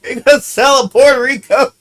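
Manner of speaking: can't stop laughing at the absurd news; real laughter breaking into the sentence. Real, not acted. Cracking up at the news.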